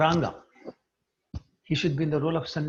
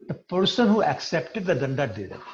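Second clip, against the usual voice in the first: ordinary delivery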